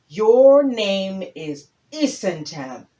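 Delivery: slow, loud, every word landed; loud tortoise announcement. Acted. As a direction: announce the name as loud as a turtle can. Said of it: "he said in a very loud voice".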